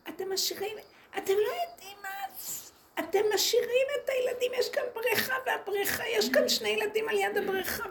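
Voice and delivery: shrill panicked mother, imitated mockingly; mimicking a woman yelling about the pool; imitating her screaming. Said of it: "היא צועקת".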